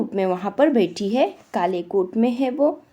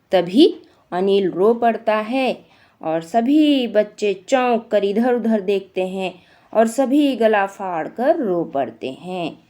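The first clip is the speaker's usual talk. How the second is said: ordinary delivery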